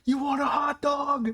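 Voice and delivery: high shouted offer; obnoxious shout. Retold or imitated. Fan offering the player a hot dog, high obnoxious shout. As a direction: yell it across the stands, brash and cheeky. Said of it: "obnoxiously yelling".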